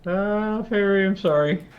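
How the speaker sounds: lightly acted sorry to a party member; sheepish apology. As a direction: apologise in character, voice lifted. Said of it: "walk over to Fairy and be like".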